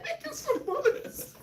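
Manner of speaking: hammed-up voice lost in giggles; laughing quote. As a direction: squeak it out while laughing. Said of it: "He said".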